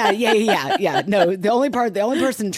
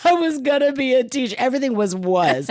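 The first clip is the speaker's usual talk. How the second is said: high, wailing mock despair; hammed-up tearful lament, pitched way up; mock sobbing; 9 semitones higher, 4 dB louder than usual. Sobbing student telling paramedic of lost plans, mock despair. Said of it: "uncontrollably sobbing".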